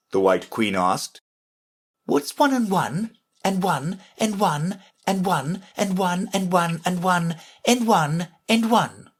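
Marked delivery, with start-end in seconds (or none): 2.1-9.1 high sing-song string of sums. Acted. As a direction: reel off the sum in a high voice.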